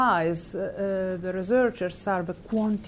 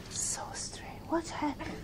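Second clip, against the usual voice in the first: whispered pity; 8 semitones higher, 12 dB quieter than usual. Retold or imitated. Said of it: "He said".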